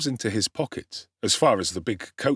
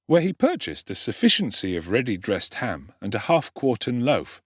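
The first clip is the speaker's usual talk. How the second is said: usual speaking voice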